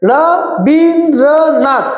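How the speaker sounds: "Rabindranath" in four slow, separate beats; syllable by syllable. Shown as a demonstration. Teacher showing each syllable to students, "Rabindranath" slow and divided. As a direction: split "Rabindranath" as ra, bin, dro, nath. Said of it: "রা হচ্ছে একটা syllable".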